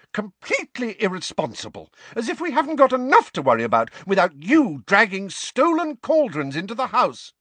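high, loud scolding tirade; shouting. A narrator's reading. Mrs Weasley scolding Mundungus, loud furious rant. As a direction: keep tearing into him, full volume. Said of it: "shouting at the top of her voice".

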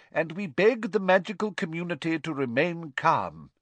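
pleading minister. A narrator's reading. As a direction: beg the public to stay calm.

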